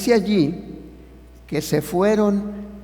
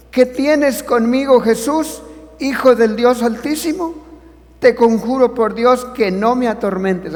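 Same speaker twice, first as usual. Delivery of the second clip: demon crying out; 7 semitones higher, 6 dB louder than usual. Acted. Predicador lee grito del demonio. (predicador da voz al demonio que grita, agudo y fuerte)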